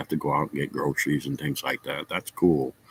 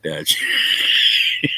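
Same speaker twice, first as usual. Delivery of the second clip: slow, breaking into laughter mid-line; can't get it out for laughing; laughing retold curse; laughing through it; 6 semitones higher than usual. Father rips mask off, laughing.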